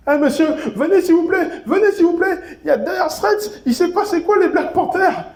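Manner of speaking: mock-tattle to the teacher, laughing; calling the teacher. [name] calls the teacher.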